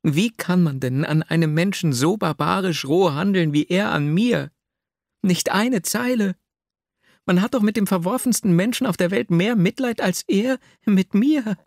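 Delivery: put-on high, agitated voice of a wronged young heroine; verzweifelte Nastjenka. A narrator's reading. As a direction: plead in rising outrage, pitched well up. Reads the distraught heroine's lines. (verzweifelte, aufgebrachte Frauenstimme)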